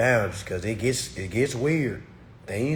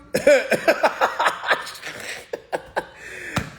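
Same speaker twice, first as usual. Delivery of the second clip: laughing; 11 semitones higher than usual. Real, not acted. Laughs at his own mockery.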